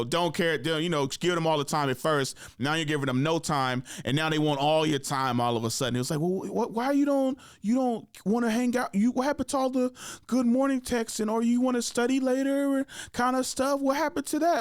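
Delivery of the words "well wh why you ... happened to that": high-pitched mock-pleading girl; needy girl. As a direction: imitate a girl stammering for attention, pitched up. Voicing a girl wanting attention back.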